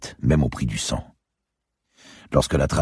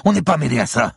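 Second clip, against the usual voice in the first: high, loud, agitated denial; desperate protest; 14 semitones higher, 5 dB louder than usual. A narrator's reading. Captive man to his interrogator, desperate protest. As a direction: protest innocence under threat. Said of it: "bava-t-il".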